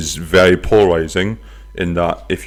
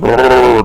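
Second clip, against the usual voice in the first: loud rolled engine rumble, higher-pitched; rough bus engine; 5 semitones higher, 7 dB louder than usual. Acted. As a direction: imitate a rough city bus roaring along. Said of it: "that's how they sound".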